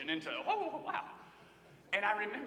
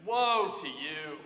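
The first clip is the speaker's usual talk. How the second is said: Woe to you said slow and louder; slow stressed woe; 3 semitones lower, 7 dB louder, much slower than usual. Shown as a demonstration. Preacher savoring Woe to you, slow and louder. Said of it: "Such a better translation".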